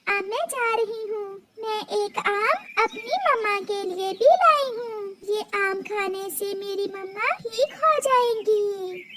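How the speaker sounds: high, soft hopeful wish; hopeful little bird. Acted. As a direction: whisper your wish with eyes closed. Little bird leaves for mother.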